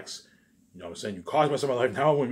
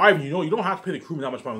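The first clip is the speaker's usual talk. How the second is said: higher, louder, hurried mock protest; protesting girlfriend; 8 semitones higher, 11 dB louder, faster than usual. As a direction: play a girlfriend objecting, fast and raised. Imagined girlfriend protests his generosity.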